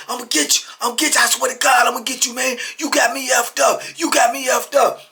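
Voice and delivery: high, loud, heated; worked-up imitation of vows of payback; retold furious threats. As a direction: act out a man raging at a door. Acted officer kicking door, threatening.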